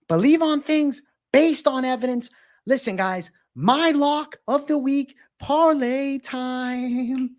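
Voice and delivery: hammed-up high announcer-style Finch; Finch impression. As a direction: stretch the parlay pitch out high and slow. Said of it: "There's the Finch impression".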